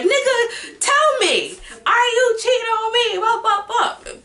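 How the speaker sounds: mock frantic girlfriend, high and loud; exasperated demand. Demanding partner admit cheating.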